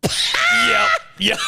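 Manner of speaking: squealing high laughter; laughing. Real, not acted. Reacting to the calendar idea, high squealing laugh. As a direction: lose it completely.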